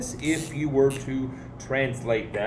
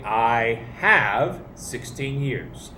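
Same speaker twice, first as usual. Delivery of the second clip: slow teaching pace; 4 semitones lower, slower than usual. Shown as a demonstration. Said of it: "You're gonna say".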